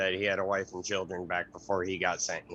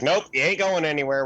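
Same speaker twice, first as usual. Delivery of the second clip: firm shout; 8 semitones higher, 8 dB louder than usual. Acted. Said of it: "be like".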